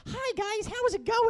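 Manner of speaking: put-on very high, squeaky kid voice; mock your radio voice as a child's squeak; sounding twelve. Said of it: "I sound twelve".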